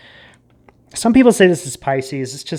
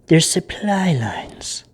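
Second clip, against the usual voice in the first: slow, breathy secret whisper; hushed, half-voiced warning; 4 dB quieter, much slower than usual. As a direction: lean in and let them in on a secret. Secretive reveal in tarot reading.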